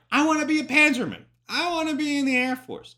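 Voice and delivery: high, loud, gung-ho wish; mock eager recruit. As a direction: pipe up like a keen volunteer. Voices eager would-be German recruits.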